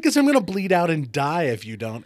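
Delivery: retold outburst to a panicked spouse; exasperated. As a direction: spell out the obvious at high volume. Exasperated answer to wife.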